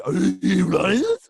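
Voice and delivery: slow Brummie try, laughing through it; laughing, drawn-out go at a Birmingham accent; half-laughing Brummie; Peaky Blinders attempt. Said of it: "Cillian Murphy in Peaky Blinders".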